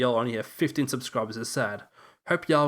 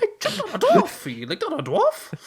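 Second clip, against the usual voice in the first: squeaky cartoon voice; 5 dB louder than usual. Jokey cartoon voice banter.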